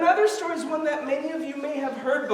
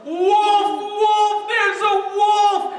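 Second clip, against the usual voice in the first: higher, louder drawn-out cry; screaming alarm; 8 semitones higher, 6 dB louder, much slower than usual. Acted. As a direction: call out to the whole valley. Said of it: "he screams at the top of his lungs".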